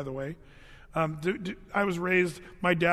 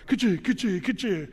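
imitated nail gun bursts, punchy; nail gun noise; 4 semitones higher, 3 dB louder than usual. Dad on the roof with the nail gun, mock nail gun noise. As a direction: spit out the nail gun sound. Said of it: "He's like".

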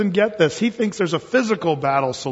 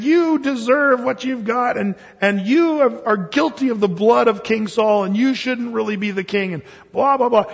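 raised, accusing voice with a higher pitch; mock yelling; 6 semitones higher than usual. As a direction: scold the king as an angry old man. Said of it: "he's yelling at him saying".

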